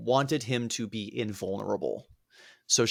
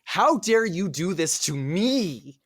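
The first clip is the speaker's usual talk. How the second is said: hammed-up heroic outrage; 4 semitones higher than usual. Achilles shields Iphigenia, slowly declaiming mock outrage at Agamemnon. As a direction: declaim it like a pompous hero. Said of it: "He puts Iphigenia behind him and goes".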